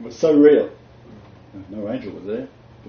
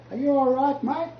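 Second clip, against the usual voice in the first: high, faint distant call; far-off call. Retold or imitated. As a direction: sound like a voice from a mile away. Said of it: "Like he was a mile away".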